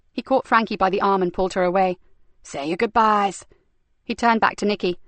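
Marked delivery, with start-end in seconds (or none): 2.4-3.5 sneering villainess. Acted. Frankie taunts Stevie over the captive, slow sneering. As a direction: savour the cruelty like a treat.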